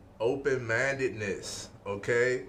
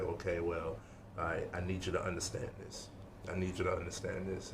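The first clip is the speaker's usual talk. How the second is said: serious tone; 3 semitones lower, 7 dB quieter, faster than usual. Shown as a demonstration. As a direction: lower and softer, earnest and pressing. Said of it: "it's a serious tone".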